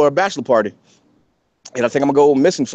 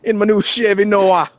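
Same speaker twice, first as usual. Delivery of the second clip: hammed-up Jamaican accent, pitched higher; Jamaican car thief; 5 semitones higher than usual. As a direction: boast in a put-on Jamaican patois. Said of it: "here's my Jamaican, uh, uh".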